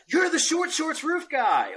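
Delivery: high, drawn-out call of recognition; slow, high-pitched mock fan; imitating excited fans. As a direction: call out like a starstruck stranger. Imitates excited strangers recognizing him.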